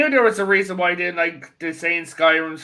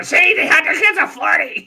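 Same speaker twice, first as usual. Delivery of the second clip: mock shout; 8 semitones higher than usual. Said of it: "She shouts that to her daughter".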